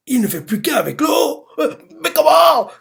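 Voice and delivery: high, loud shocked outcry; shocked cry. Retold or imitated. Finn watching the fight in shock, high loud cry. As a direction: gasp it out in disbelief. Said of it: "On voit Phil choqué, il fait".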